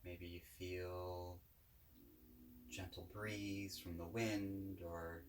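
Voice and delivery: calm, unhurried guided-meditation voice. Real, not acted. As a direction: guide listeners gently, with long pauses between phrases.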